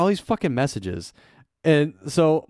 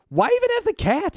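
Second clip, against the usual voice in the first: incredulous. Retold or imitated. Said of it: "And I was like".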